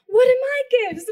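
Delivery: high, loud excited demand; eager question, imitated. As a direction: act out a friend impatient to know. Retold eager question from colleague.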